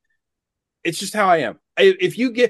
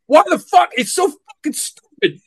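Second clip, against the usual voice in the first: high, incredulous exasperation; fed-up ranting; exasperated outburst; 8 semitones higher than usual. Real, not acted. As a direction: blurt it out in real disbelief. Exasperated rant about a film's plot.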